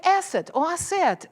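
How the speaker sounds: asset or asset, stress moved; stress contrast. Retold or imitated. Teacher contrasting asset stresses. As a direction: contrast the two ways to say asset. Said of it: "And then I just like".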